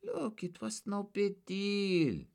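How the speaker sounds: high, slow and uneasy; squirming excuse. A narrator's reading. Suspect squirming under questioning, uneasy. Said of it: "Weissman offered, squirming".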